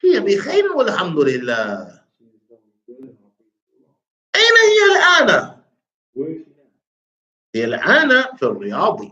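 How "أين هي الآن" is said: very high, quick questioner's voice; dialogue questioner. Acted. Teacher reading the questioner's part to a student, high quick voice. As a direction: pitch the question way up and fire it off.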